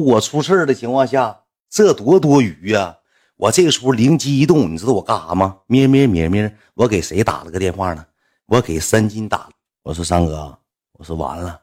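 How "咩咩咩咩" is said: humming the ring tone, mock buzz; phone ring imitation. Telling the story, he hums the call ringing out.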